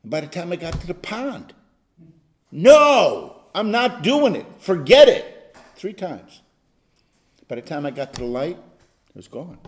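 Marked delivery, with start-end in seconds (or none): 2.5-5.6 louder, firm no; firm refusal. Retold or imitated. Pastor retelling refusing temptation by the pond, firm louder no. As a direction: brush the temptation off firmly.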